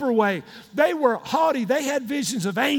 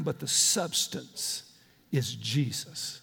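reverent hush; 11 semitones lower, 7 dB quieter, slower than usual. Acted. Reverent climax of quote.

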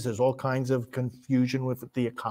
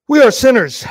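loud, high outburst in prayer; cried-out confession; 9 semitones higher, 15 dB louder than usual. Real, not acted. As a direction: burst out with the confession. Prayer, confession cried out then repeated quietly.

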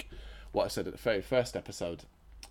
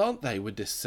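usual speaking voice

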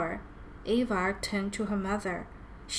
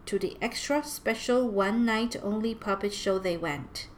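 usual speaking voice

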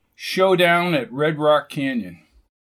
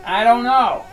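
shouting; 8 semitones higher, 4 dB louder than usual. Acted. Answer shouted over the buzz.

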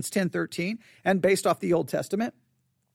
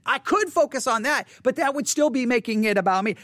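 ordinary talk, nothing marked

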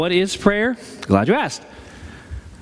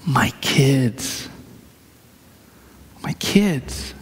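quoted through tears, fading to a whisper; tearful quote; 4 semitones lower, much slower than usual. Retold or imitated. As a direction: echo her sobbing plea, dropping to a breath. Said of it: "in between her tears".

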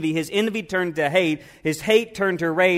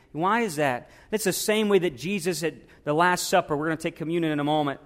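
normal talking voice

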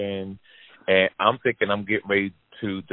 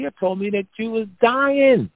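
much higher, louder, stunned explaining; high, bewildered, louder telling; incredulous retelling; 12 semitones higher, 4 dB louder than usual. Retold or imitated. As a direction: lay out the lie in disbelief. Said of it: "I said".

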